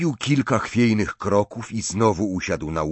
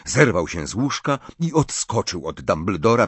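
plain reading voice

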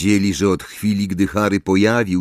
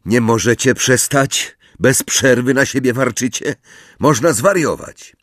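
irritated outburst; 6 semitones higher, 3 dB louder than usual. Acted. Harry snapping at friends.

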